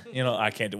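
normal talking voice

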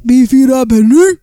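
shouted name, loud and ringing; loud yell. Acted. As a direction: bellow the name. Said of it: "That hurt my ears".

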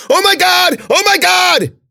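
high, loud panicked scream; frantic full-voiced shriek. A narrator's reading. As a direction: scream it in terror, twice over. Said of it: "bit the hand and screamed".

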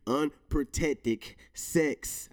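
Unprotected sex stretched very slowly with a laugh; tongue-tied on Unprotected sex; drawn-out stumble. Shown as a demonstration. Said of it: "I can't say it".

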